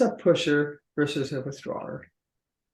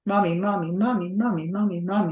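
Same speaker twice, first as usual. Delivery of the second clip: put-on insistent little child calling mommy over and over; lightly voiced child tugging at a parent; pestering child. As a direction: nag like a small child wanting attention.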